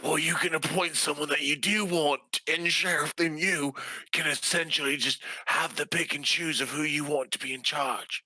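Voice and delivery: ordinary talk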